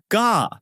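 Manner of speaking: sharp high Gah of being hit; pained gasp. A narrator's reading. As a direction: yelp as if punched. Owner takes another blow.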